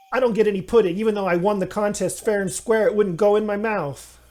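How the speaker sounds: whiny rant. Acted. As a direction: whine like a spoiled brat. Gnome whining about pudding.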